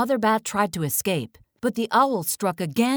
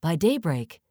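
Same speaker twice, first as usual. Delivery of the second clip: ordinary delivery, nothing marked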